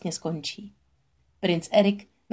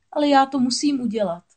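high, louder protest; insist, pitched up and firm; insistent young mermaid; 7 semitones higher, 5 dB louder than usual. A narrator's reading. Mermaid answering the crab's warning, insistent.

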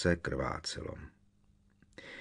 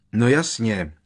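louder, higher exclamation; angry outburst; 4 semitones higher, 7 dB louder than usual. A narrator's reading. Harry snaps back at his teacher in class, shouting out. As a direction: burst out loudly. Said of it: "rozkřikl se Harry".

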